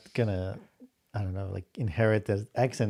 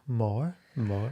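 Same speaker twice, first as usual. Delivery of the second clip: imitating British "more" without the r; "more" as a British "maw"; British accent; 4 dB quieter than usual. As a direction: copy a child's British "more". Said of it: "it's very British".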